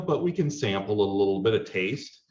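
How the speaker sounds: ordinary talk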